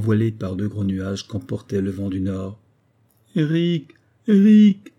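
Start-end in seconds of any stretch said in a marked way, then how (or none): 3.4-3.9 a higher, lonely first call into the dark; plaintive call. Acted. Alone in the night, Marguerite calls for Eric, plaintive. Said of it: "cria-t-elle d'une voix plaintive". 4.3-4.9 much higher and louder, a desperate wail of the name; plaintive cry. Acted. No answer comes, Marguerite cries Eric's name, desperate and loud. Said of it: "cria-t-elle d'une voix plaintive".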